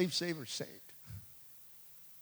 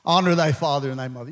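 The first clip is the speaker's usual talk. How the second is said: lower, fast, mock booming commandments; movie Moses voice; 10 semitones lower, faster than usual. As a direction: imitate the film's voice of God lightly. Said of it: "you seen the Ten Commandments, the movie?".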